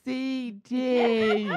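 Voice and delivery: put-on high, insistent old woman voice; old lady voice. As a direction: correct her friend, right in her face. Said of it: "goes right to her face".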